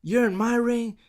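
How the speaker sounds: high-pitched mock bossy boss; lightly voiced authority figure giving orders; Stephanie imitation. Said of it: "So Stephanie's like".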